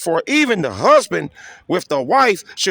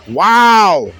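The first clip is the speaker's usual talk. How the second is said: loud wow; 6 dB louder than usual. Real, not acted. Reacting to his own line.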